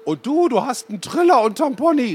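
a granny teasing over the bus mic; Oma Inge on the microphone. A narrator's reading. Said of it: "rief Oma Inge durchs Mikrofon". (Oma Inge ruft durchs Mikrofon, viel höher und lauter)

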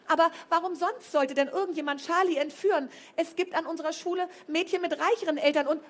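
high, loud angry protest; angry outburst. A narrator's reading. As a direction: argue hotly with the headmistress. Flo argues with headmistress.

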